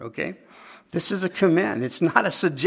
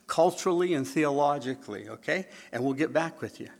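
ordinary delivery

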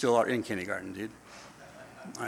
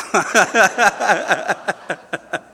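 hearty laugh; 6 semitones higher, 7 dB louder than usual. Real, not acted. Laughing at himself.

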